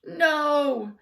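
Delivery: loud, high shout. A narrator's reading. Principal shouting at the kids. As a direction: bark the no. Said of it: "shouted Doctor Carbles".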